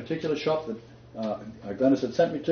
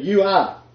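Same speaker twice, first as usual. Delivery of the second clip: "You are" said firmly, higher and louder, falling hard on "are"; emphatic; 5 semitones higher, 5 dB louder than usual. Shown as a demonstration. Preacher stressing Christ's words, emphatic and louder. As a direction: stamp down "You are" with full weight.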